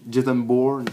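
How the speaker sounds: Jason Bourne said the American way, "Bourne" as an English "born"; "Jason Bourne" with an American accent, English vowels and r; American pronunciation. Shown as a demonstration. Explaining his favourite trilogy, says the name in American. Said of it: "moi je dis à l'américaine".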